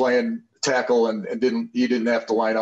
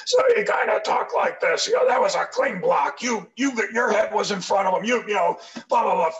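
strained high imitation of a coach with a damaged throat; crushed-larynx coach voice; 9 semitones higher than usual. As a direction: talk like the coach whose larynx got crushed. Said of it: "his larynx crushed".

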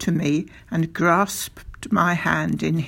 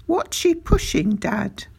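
put-on high-pitched child's question; curious boy; 11 semitones higher than usual. A narrator's reading. Boy asking father about the distant figure, curious and high. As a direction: ask Dad in a high, curious voice.

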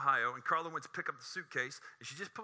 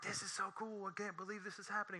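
inner thrill; 7 semitones higher, 8 dB quieter than usual. Retold or imitated. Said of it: "In my mind, I'm like".

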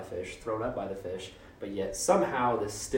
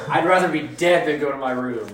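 put-on loud, higher whiny child shouting; four-year-old tantrum; 5 semitones higher, 11 dB louder than usual. Jonah mocked as a sulking toddler with a loud whiny fit. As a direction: pitch a bratty toddler fit. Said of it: "the tantruming of a four-year-old".

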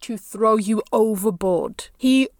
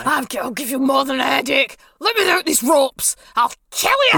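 furious threat; 2 semitones higher than usual. Acted. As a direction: threaten them while straining at the ropes. Bound captive threatens the party.

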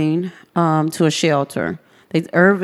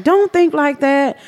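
reassuring neighbor; 9 semitones higher than usual. Retold or imitated. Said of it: "she was like".

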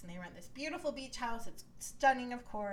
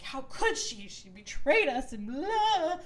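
mock teary outrage; 5 semitones higher, 6 dB louder, slower than usual. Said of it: "crying and emotional".

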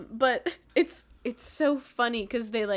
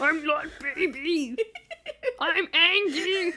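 high, slow mock-baby pout; angry baby talk; 6 semitones higher, 3 dB louder than usual. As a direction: play a cross toddler, half laughing. Playing a pouting angry baby.

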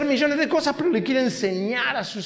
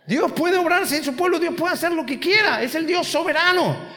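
emphatic preaching; 7 semitones higher than usual. Acted. Emphatic sovereign statement.

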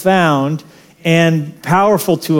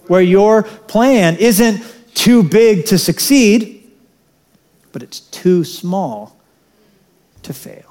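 slow closing line; 3 semitones higher, slower than usual. Real, not acted. Sermon closing punchline.

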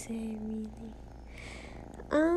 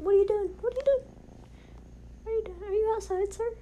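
higher, alarmed repeated question; high startled what are you doing; 10 semitones higher, much faster than usual. Real, not acted. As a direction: blurt it out in disbelief. Spotting a man through window.

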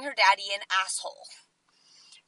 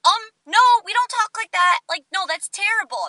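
scolding; 5 semitones higher, 7 dB louder than usual. Retold or imitated. Scolding a five-year-old.

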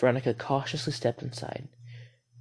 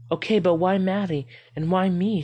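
usual speaking voice

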